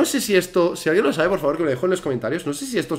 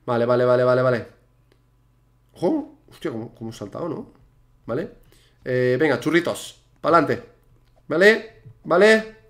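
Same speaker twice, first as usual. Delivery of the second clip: ordinary talk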